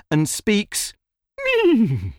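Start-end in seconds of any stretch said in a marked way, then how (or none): 1.4-2.1 high-pitched little neigh; horse whinny. A narrator's reading. Horse answers the boy, whinnying. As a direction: neigh before you speak.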